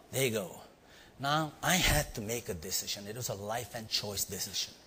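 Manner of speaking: ordinary talk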